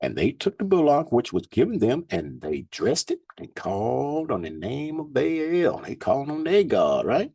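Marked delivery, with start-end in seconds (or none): 0.0-5.8 scripture reading. Acted. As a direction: lift the verses up like a preacher. Reads scripture aloud.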